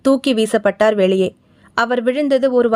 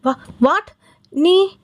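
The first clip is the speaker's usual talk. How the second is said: mildly shocked, rising question; startled stammer; 6 semitones higher, much slower than usual. A narrator's reading. Groom recognises the threatening caller, startled stammer. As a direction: stumble on the first word, then jump high. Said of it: "பிடுங்கப்பட்டது pole துள்ளினான்".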